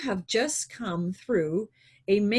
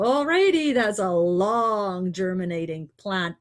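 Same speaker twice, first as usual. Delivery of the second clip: mock realization; 5 dB louder than usual. Speaker reacting to a six-year-old seed, mock realization.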